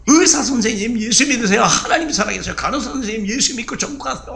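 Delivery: high, eager evangelizing; fervent preaching. Retold or imitated. Patient urging doctor and nurse to believe, eager and high. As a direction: urge the nurses to believe, beaming.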